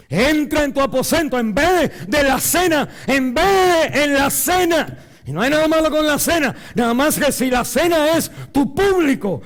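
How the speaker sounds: high, loud, impassioned shouting; preaching at full force. Real, not acted. Preacher crying out to the congregation about private prayer. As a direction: preach it like a revival.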